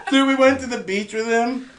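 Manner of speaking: normal talking voice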